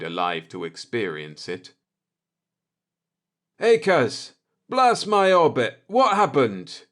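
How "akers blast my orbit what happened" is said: high, loud alarmed call across the room; startled shout. Acted. Jordan shouting at Akers in the doorway, high and loud.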